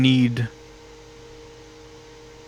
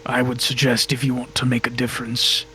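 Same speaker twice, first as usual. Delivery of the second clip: condescending god; 5 dB quieter than usual. Acted. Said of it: "He says".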